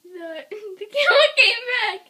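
usual speaking voice

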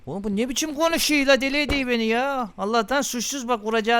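high, loud irritated complaint; exasperated. Real, not acted. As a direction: driven mad by a character. Annoyed by the character's talk.

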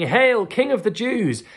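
loud, high mock salute; soldiers mocking. Soldiers taunting Jesus in the purple robe, loud mockery. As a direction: jeer it like a cruel soldier.

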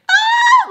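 high, loud mock scared scream. Scared of her alter ego, high loud scream. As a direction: shriek at your alter ego. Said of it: "I get kinda scared".

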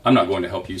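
ordinary delivery, nothing marked

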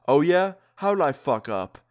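imitated cocky comeback, high loud opening; retold angry retort; angry challenge. Basketball player challenging the skater's friend, imitated anger. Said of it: "Mister Angry Basketball Guy goes".

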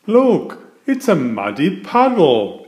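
high, loud excited little pig; Peppa crying out. A narrator's reading. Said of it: "cries Peppa".

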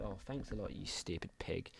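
soft, muted grumble at a pig; voice gone down. Real, not acted. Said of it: "like, my voice went down".

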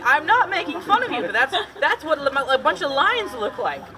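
high, loud retold protest; exasperated comic self-defence; mock protest. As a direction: protest your innocence, hammed up for laughs. Retold protest to friend, laughing crowd.

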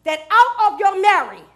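fervent preacher's cadence, loud and ringing over music; preaching. Acted. Pastor preaching to his congregation, full force.

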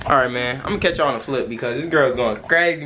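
normal talking voice

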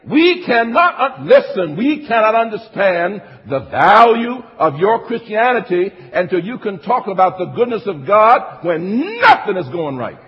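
loud, high, emphatic pulpit proclamation; impassioned preacher in full cry; full-force preaching. Real, not acted. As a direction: drive it home to the whole congregation.

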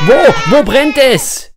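high, loud panicked questions; startled caretaker asking in alarm. Acted. Caretaker thinks there's a fire.

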